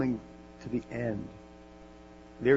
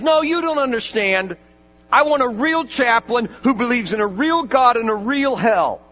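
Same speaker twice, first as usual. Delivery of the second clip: "you don't understand" thrown back louder and higher; angry rebuttal; 8 semitones higher, 8 dB louder than usual. Retold or imitated. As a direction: turn her words against her, fuming. He says.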